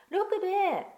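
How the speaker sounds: high, loud call down the hole. Acted. Children calling the curled-up dog, high and loud. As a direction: call his name to rouse him.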